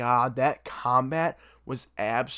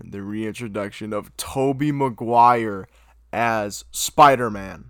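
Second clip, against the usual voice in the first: hyped announcement; 9 dB louder, slower than usual. Real, not acted. Hyping the big reveal.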